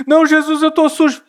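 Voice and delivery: protesting sinner. Acted.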